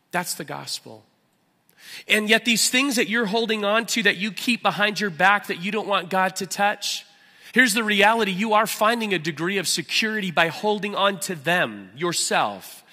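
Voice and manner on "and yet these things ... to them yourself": loud, high, driving sermon voice; impassioned pulpit preaching; full-force preaching. Acted. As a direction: preach it out with fire to the room.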